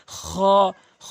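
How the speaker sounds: খ rasped from the end of the throat, drawn out; back-of-throat kh. Shown as a demonstration. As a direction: sound খ as a throaty fricative. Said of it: "এভাবে sound করে".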